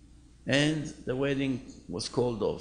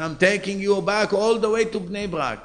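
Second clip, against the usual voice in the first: mock angry; 5 semitones higher, 7 dB louder than usual. Driver turns car around angrily.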